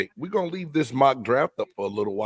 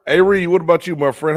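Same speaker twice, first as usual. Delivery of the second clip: ordinary delivery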